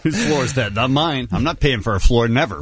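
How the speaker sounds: lightly voiced low-pitched grumbler from the clip; mimicking the video's character. Mimics the video just played.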